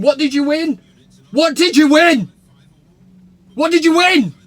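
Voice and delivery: high, loud jeering chant; taunting shout. Pretend. Taunting Shearer as a rival fan.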